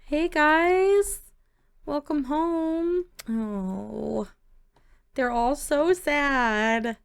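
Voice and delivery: high, drawn-out coo; sympathetic greeting. Pretend. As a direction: coo pityingly over the kids. Cooing at sad children coming home.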